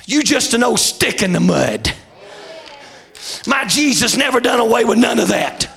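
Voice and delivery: high, shouted hellfire sermon; full-force preaching. Acted. Preacher rouses the congregation about miracles, shouting. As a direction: preach it hard to the rafters.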